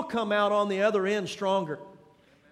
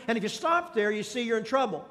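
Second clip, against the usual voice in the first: normal talking voice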